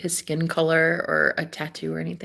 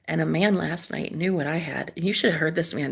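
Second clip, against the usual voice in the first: ordinary talk, nothing marked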